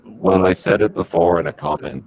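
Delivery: normal talking voice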